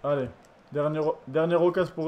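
normal talking voice

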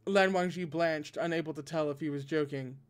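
ordinary delivery

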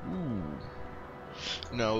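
ordinary talk, nothing marked

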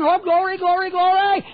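high, bright cries of glory; joyful shouting. Retold or imitated. Congregation rejoicing at the altar, shouting glory. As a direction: call out glory in church rejoicing. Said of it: "they were all shouting".

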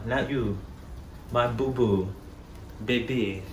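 normal talking voice

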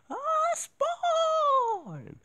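high shrill game shout; high battle cry. Retold or imitated. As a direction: screech the attack call.

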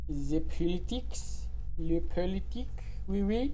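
put-on French accent. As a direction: fake French accent on politics. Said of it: "Why am I talking in a French accent?".

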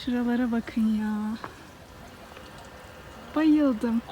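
ordinary talk, nothing marked

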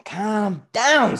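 high, loud, mock-soothing; condescending. A narrator's reading. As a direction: talk down loudly to the waiter. Father talking down to waiter.

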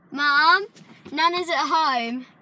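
loud, high shout to Mum; calling out. Real, not acted. Child telling mum that Nana's at home, calling out loudly. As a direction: call it across the car park.

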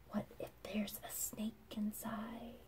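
put-on hushed, breathless whisper of a frightened child; spooked little girl whispering her fear; scared whisper. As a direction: whisper it as if the snake might hear. Scared girl imagines snake.

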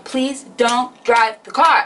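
drawn-out begging, word by word; slow, insistent mock pleading; slow, emphatic retold plea. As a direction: spell out the plea slowly and firmly. Plea escalates as foot hits pedal.

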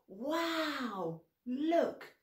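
high amazed Wow, look. Shown as a demonstration. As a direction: sound amazed at what you see. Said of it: "Can you do that?".

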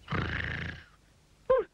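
very high startled squeal; terrified shriek. A narrator's reading. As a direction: shriek at a sudden growl. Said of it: "shrieked Mister Jelly".

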